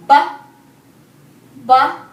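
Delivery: loud punchy Ba sound; sounding out words. Shown as a demonstration. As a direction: say Ba as a crisp first sound. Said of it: "Here is the next one".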